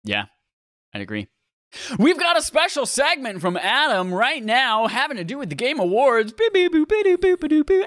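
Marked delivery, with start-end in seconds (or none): 2.0-6.3 high, loud showman announcing a segment; hype announcer voice. Pretend. Introducing a special segment. 6.3-7.9 high, loud trumpet fanfare sung as boo; mock fanfare. Fanfare for the segment.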